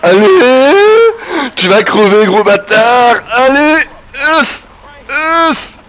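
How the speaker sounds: loud, high, fierce yelling at the screen; hyped yelling. Real, not acted. Streamer screaming at the game villain, loud and high. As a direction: roar him on as the kill lands.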